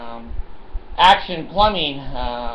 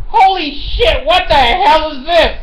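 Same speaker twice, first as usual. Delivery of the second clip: high, loud stunned outburst; a cop gasping at the sight of it; mock startled police officer; shocked cop; 8 semitones higher, 5 dB louder than usual. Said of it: "they look at it like".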